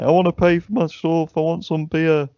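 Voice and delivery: high, whining crackhead plea, lightly mocked; mock whiny crackhead. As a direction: whine it like a drunk begging for beer. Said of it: "he was like".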